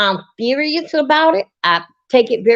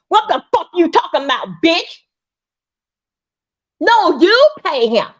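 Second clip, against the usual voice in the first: higher, heated retort; indignant outburst; 5 semitones higher than usual. Real, not acted. A tenant angrily rejecting the landlady's repair bill. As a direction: demand she pay him.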